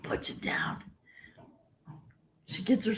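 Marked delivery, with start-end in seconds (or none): 0.0-0.8 hushed, dramatic whisper. A narrator's reading. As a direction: drop to a whisper for suspense. Dramatic story pause.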